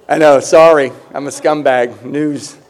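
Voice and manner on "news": ordinary talk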